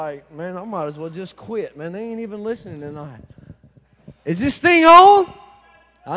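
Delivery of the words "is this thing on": loud, slow teasing is-this-on call; mock mic check. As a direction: boom it out to wake the room. Mock mic check at quiet crowd.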